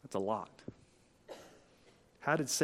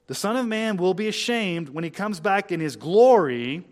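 loud, high scripture proclamation; emphatic pulpit reading; preaching at full force; 4 semitones higher, 10 dB louder than usual. Acted. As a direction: proclaim the verse with rising force. Preacher reads verse forcefully.

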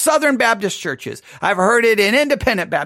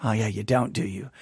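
quiet teasing; 12 semitones lower, 9 dB quieter than usual. Real, not acted. Teasing listeners' claim.

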